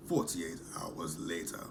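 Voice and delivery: low, slow put-on announcer voice; time-card announcer. Recapper repeats the show's time jump to viewers, slow announcer voice. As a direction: read it like a slow, mock-dramatic caption.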